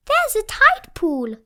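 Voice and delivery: put-on high, loud excited child voice; Peppa excited. Peppa finding a tide pool at the beach, high excited. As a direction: announce it with delight. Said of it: "says Peppa".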